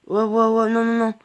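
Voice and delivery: panicking as the enemy closes in; alarmed excitement. Real, not acted. Gamer panics as a strong enemy attacks, loud alarmed yelps.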